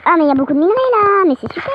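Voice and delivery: high, loud burst of delight; delighted excitement. Real, not acted. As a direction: exclaim at a lucky find. Discovers lots of ore. (voix aiguë, ravie et excitée)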